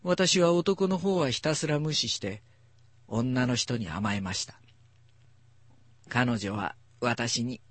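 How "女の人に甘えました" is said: plain reading voice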